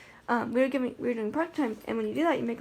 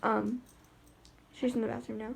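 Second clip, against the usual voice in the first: ordinary talk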